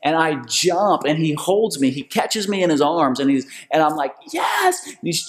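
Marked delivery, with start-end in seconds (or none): 4.3-4.8 high, elated cry; triumphant shout. Retold or imitated. Racer leaping into his boss's arms, exultant shout. Acting own triumph at finish.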